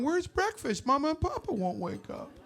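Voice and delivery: put-on small, high child's voice, soft; little child voice, hushed and worried; lost little kid. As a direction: play the scared little kid looking for breakfast.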